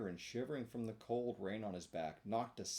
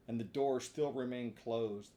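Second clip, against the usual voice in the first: plain reading voice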